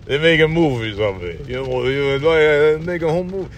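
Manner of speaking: slurred, mumbling aging action star; marble-mouthed old movie-hero grumble; Sylvester Stallone. Acted. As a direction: mumble it on repeat like a befuddled tough guy. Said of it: "Stallone is just like".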